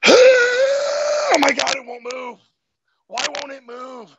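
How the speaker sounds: high strained yelp, then a hushed, choked plea; mock agony of legs that just won't move; a client panicking when the leg won't budge; straining panic.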